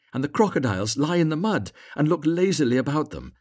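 high, lively swallow's voice, earnest and persuasive; eager little swallow. A narrator's reading.

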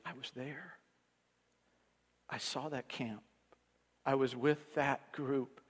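softly voicing the old veteran; quiet veteran. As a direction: let it drop almost to nothing. Old veteran quietly recalling the camp.

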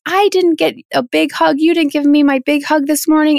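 put-on high, plaintive little-kid voice; imitated sad child. Six-year-old tells mom about the missed hug, imitated sad child. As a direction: play a six-year-old earnestly stating her hurt. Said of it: "I'm really feeling sad about that".

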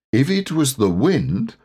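pondering Owl; considering. A narrator's reading. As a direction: weigh the matter aloud. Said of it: "considering the matter".

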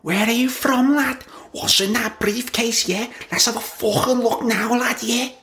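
very high squawky Scouse seagull voice, aggressive. Pretend. Podcaster imagining a seagull accosting a bloke, high squeaky Scouse threat. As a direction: squeak it out like a cocky Liverpool gull. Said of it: "You can just imagine the voice of this seagull".

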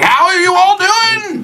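high, slow, booming hail-fellow greeting; hearty adventurer greeting. Acted. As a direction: call out big as a dashing hero. Game master voices swaggering good-looking adventurer.

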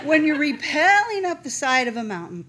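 high, loud mock lecture; know-it-all. Sister resumes her climbing lesson, mock know-it-all. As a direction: imitate a superior instructor, overemphatic. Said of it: "in her know-it-all-ness".

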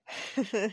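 short amused chuckle; light laugh. Acted. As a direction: let out a brief laugh. Amused in acted dialogue.

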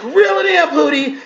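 very high teasing woman's voice; imitating Omarosa high and mocking. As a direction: squeak reel it in way up high. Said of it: "She's like".

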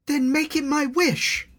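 put-on high, urgent young girl; pleading girl. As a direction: beg him to fly up there. Elna insists on wish.

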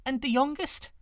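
high, urgent question; anxious girl. A narrator's reading. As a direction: ask it dreading the answer. Girl asks, distressed.

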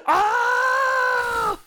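very high, loud shrieking death scream; death cry. Retold or imitated. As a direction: scream like you're falling off a cliff. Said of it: "it also has a great death scream if you fall".

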